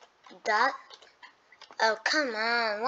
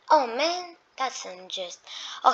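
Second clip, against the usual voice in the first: usual speaking voice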